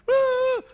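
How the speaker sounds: loud, very high crying wail; mock wife's wail. Preacher imitates a wife in a marital fight, mock wailing. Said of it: "istri nangis".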